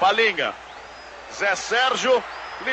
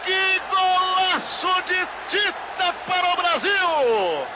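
building to a long, higher roar; explosive goal commentary; 5 semitones higher than usual. Real, not acted. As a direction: erupt as the ball hits the net.

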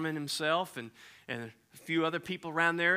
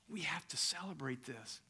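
unvoiced hush, much softer; whisper; 12 dB quieter than usual. Preacher ending father's speech, hushed whisper. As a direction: breathe it like a secret.

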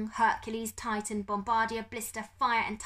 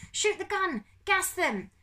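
high, loud yell; frantic shouted order; 12 semitones higher, 5 dB louder, slower than usual. Acted. Man covered in attacking beetles, shouting orders. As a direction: yell the commands, pitched right up.

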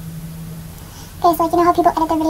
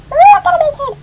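surprised; 11 semitones higher, 8 dB louder than usual. Real, not acted.

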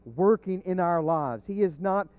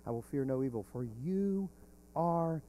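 soft assurance; 2 semitones lower, 6 dB quieter than usual. Retold or imitated. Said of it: "And yet he said".

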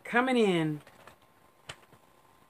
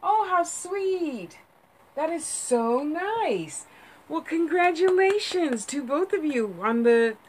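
sweet gushing; 9 semitones higher, slower than usual. Real, not acted. Reacting to a viewer's good news.

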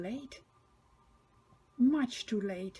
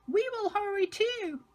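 much higher, light coach voice; coaches' voice; 10 semitones higher than usual. A narrator's reading. Annie and Clarabel answering Thomas in a high voice. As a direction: chirp it like the little coaches. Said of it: "His coaches Annie and Clarabel say".

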